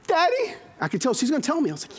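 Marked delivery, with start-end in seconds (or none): 0.1-0.5 little daughter. Retold or imitated. Voicing young daughter.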